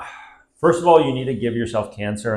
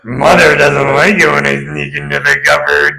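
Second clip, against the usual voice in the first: higher, louder creepy role-play voice; hammed-up creepy naughty boy; creepy naughty child; 6 semitones higher, 7 dB louder than usual. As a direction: act a creepy confession about Mother, pitched up. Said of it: "Stop it, it's creepy".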